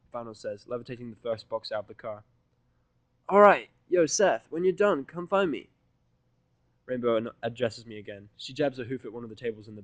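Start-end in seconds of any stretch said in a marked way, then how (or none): 3.3-5.7 much higher, loud, casual call; brash tomboy calling out; Rainbow's voice. Acted.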